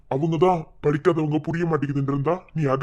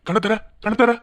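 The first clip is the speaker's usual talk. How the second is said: put-on coaxing baby talk; 8 semitones higher, 6 dB louder than usual. Father coaxing child to open eyes.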